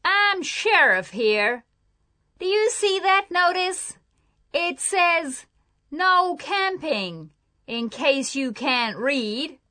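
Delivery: heated, emphatic scolding; angry sheriff. A narrator's reading. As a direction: lay down the law as an irate sheriff. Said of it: "He looked very angry".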